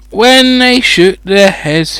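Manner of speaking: usual speaking voice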